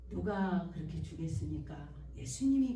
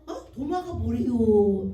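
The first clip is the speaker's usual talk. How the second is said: puzzled question; 2 semitones higher, 10 dB louder, slower than usual. Retold or imitated. Bewildered retold question.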